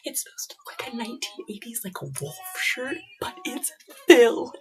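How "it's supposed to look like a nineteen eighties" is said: soft whisper so he won't hear; secret whisper. Real, not acted. Hiding the gift from her husband, soft whisper.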